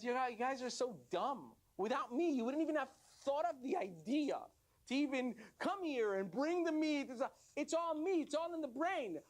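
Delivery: high-pitched, self-important insistence; indignant boasting. Acted. Brain belittling the other body parts, indignant boasting.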